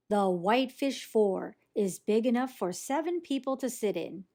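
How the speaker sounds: ordinary delivery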